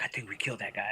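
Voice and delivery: low whisper, nearly voiceless; hushed confession. Retold or imitated. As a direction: whisper it like a dreadful secret. Retold hushed fearful confession.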